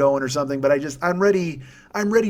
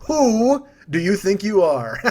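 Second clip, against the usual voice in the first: slow, drawn-out Who like a hooting owl; comic owl-style hooting question; 2 semitones higher, 5 dB louder, slower than usual. Acted. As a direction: stretch the words into an owl call. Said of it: "what an owl says".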